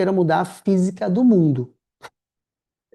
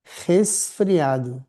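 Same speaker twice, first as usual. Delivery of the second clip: drawn-out word; much slower than usual. Shown as a demonstration. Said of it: "ninguém fala resfriado".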